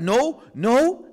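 sharp, loud angry protest; angry denial. Retold or imitated. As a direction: snap it out in anger. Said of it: "and I'll be angry".

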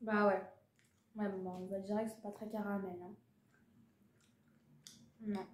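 talking while eating candy; chewing. Real, not acted. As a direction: mouth partly full. Seen 2: bites candy before; holds half piece; puts more in mouth ~6s.

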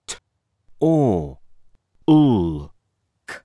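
"Or. L. K." as separate sounds, "L" silent part; sounding out words. Shown as a demonstration. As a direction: segment the sounds one at a time. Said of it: "It makes the sound or".

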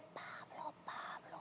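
very soft, hushed name-calling; whispered voice. Retold or imitated. Night voice calling the unborn son's name, whispered. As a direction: whisper it like a voice heard in sleep. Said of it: "otra vez te digo que me dice".

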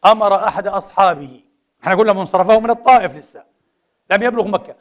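ordinary talk

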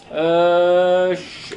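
usual speaking voice

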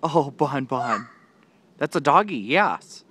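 ordinary delivery

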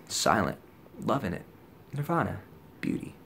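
soft, spaced-out single words; dreamy murmur, fading away; blissed-out hush. Retold or imitated. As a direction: let each word drift off softly. Narrating his blissed-out walk.

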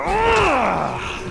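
high, drawn-out mock battle grunt; aggressive grunt. Player imitating himself when kicking, aggressive grunt. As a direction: let out an exaggerated aggressive yell. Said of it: "I get so aggressive".

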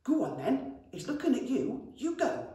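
goblin urging. A narrator's reading. Goblin urges brother toward dragon, nervously. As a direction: push your brother forward, nervous.

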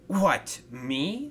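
high startled question, then dropping; mildly spluttering surprise. Acted. Thomas is urged to talk to the stranger, spluttering mildly. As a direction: balk at being picked. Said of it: "spluttered Thomas".

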